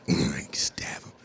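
hushed low whisper; whispered aside. Real, not acted. Comedian whispering a dark aside to audience. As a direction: breathe it like a secret.

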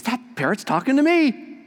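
high, amazed; astonished lady. Acted. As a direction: gasp at the talking bird. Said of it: "She goes".

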